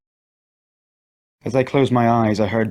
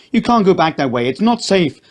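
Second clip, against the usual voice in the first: higher, louder urgent command; urgent warning; 9 semitones higher, 5 dB louder than usual. A narrator's reading. Man stops mother running back, louder urgent warning. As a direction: hold her back firmly.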